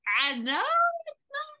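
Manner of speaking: a sudden high cry of no; the gut yelping that it is not fine; inner protest. Retold or imitated. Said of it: "everything was like".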